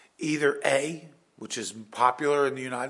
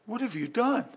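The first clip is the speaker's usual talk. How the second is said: God's question; 10 semitones higher than usual. Acted. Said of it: "it's what the Lord said".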